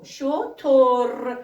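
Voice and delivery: شتر stretched very slowly, sound by sound; teacher sounding out شتر for the class. Shown as a demonstration. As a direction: drag out شتر to show the o after ش. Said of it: "اینجا شتو رو ببینید".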